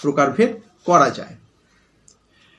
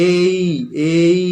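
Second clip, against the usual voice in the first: each "এই" drawn out very slowly; prolonged call; much slower than usual. Shown as a demonstration. Teacher repeating the call slowly, stretched "এই, এই". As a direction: hold both "এই" long, like a call across a yard. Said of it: "অনেকক্ষণ ধরে হলো".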